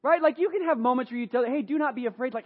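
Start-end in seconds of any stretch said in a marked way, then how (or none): 1.4-2.3 higher, breezy, empty comfort; casual brush-off said lightly; offhand reassurance. Acted. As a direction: toss it off without meaning it. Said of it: "David actually meant what he said".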